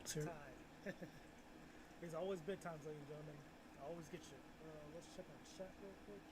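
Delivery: faint, far-off playback of own voice; distant replay. Real, not acted. Streamer's own clip playing back faint and far away. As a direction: let it sound like a tinny recording.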